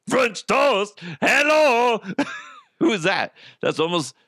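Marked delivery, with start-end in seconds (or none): 0.1-2.0 high, loud, botched Austrian impression; messed-up Arnold voice. Host mocking his broken Arnold, high strained voice. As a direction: yelp it as Arnold gone badly off the rails. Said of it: "That fucked up his voice".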